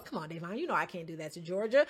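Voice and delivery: a hint of a guilty friend whining his excuse; sheepish partner. Imitating Bear backing out.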